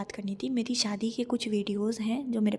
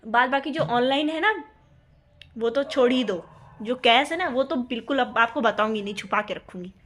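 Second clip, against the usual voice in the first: louder, playful refusal; teasing defiance; 2 semitones higher, 6 dB louder than usual. Real, not acted. Wife teasing husband she'll hide her cash, loud and playful. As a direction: taunt him that she won't tell.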